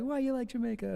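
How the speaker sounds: lightly voiced Jamaican interviewer; imitating Jamaican guy. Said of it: "He's like".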